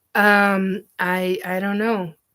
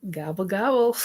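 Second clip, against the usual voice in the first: ordinary talk, nothing marked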